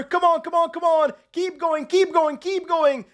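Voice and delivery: high, urgent cheers for the rider; will the rider over the line; frantic cheering. Real, not acted. Gamer cheering his rider to the finish, high and urgent.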